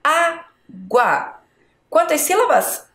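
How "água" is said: ÁGUA as two separate beats, Á and GUA; syllable by syllable. Shown as a demonstration. Teacher counting on a finger, ÁGUA syllable by syllable. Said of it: "Vamos lá com o dedo".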